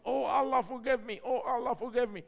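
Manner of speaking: mock panic of a frightened clerk; shouting. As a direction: clerk crying out while made to write. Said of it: "the guy start shouting".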